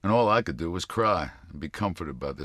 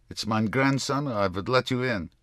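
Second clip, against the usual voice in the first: high Yiddish grandmother voice; fast, thick Yiddish, softening; thick Yiddish accent; 8 semitones higher, 5 dB louder than usual. A narrator's reading. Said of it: "Yiddish accent so thick".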